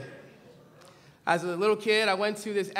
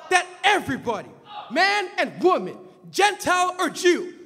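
fiery preaching; 7 semitones higher, 3 dB louder than usual. Acted. Preacher thunders at his congregation, then drops to a hush. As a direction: preach it hard from the pulpit, then let it drop.

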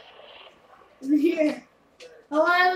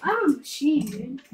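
ordinary talk

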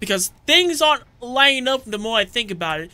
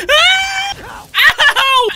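high, loud yelps of pain; 3 dB louder than usual. Real, not acted. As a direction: cry out sharply as you get hit. Getting hit in game.

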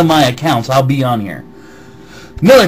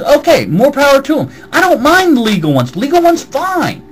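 heated rant; 10 semitones higher than usual. Real, not acted. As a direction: push back hard, voice up high. Angry rant reading comments.

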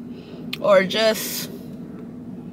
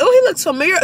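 surprised recognition; 8 semitones higher than usual. Retold or imitated. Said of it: "I'm like".

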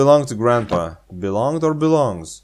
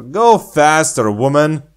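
louder slow urging; impatient call; 5 semitones higher, 7 dB louder, slower than usual. Real, not acted. Hurrying the slow walking girl, loud and drawn out. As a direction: push her to hurry, drawn out.

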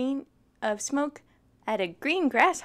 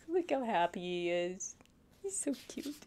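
tender aside; 5 semitones lower, 8 dB quieter than usual. Real, not acted. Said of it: "aside cooing over the picture".

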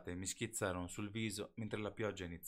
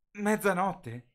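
slow, high, louder bewildered echo; dazed disbelief; 13 semitones higher, 14 dB louder, much slower than usual. A narrator's reading. Bella, disoriented, echoes Jacob's words in slow disbelief. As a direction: repeat it as if you just woke up. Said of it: "ripetei come una stupida, ancora disorientata".

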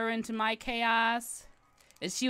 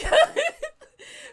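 very high loud giggle; real delighted squeal-laugh; giddy laugh; 9 dB louder than usual. Real, not acted. Giddy about meeting someone.

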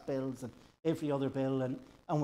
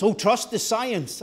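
higher, louder, drawn-out mimicry; mock slogan; 6 semitones higher, 5 dB louder, slower than usual. Preacher mimicking ministers in the pandemic, mock slogan. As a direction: parrot it slowly and emphatically, pitched up.

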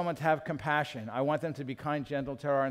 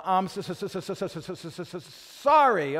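Fonzie; 2 semitones higher, 3 dB louder than usual. Retold or imitated. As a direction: struggle to force out "sorry". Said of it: "it's like the old Fonzie".

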